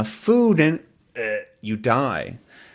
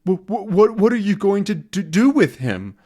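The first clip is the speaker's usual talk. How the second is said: afraid stammer; 8 semitones higher than usual. Acted. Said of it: "He's still afraid of the gorilla".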